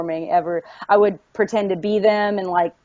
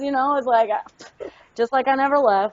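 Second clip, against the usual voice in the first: higher-pitched Southern twang; thick Southern accent; 6 semitones higher than usual. Shown as a demonstration. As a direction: slide into your back-home Southern accent. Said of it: "I just start talking like this".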